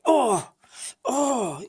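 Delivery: high, loud cry of pain at each oh. Retold or imitated. Describing chemo pain to viewers, a high loud pained cry. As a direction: cry out as if stabbed by nails.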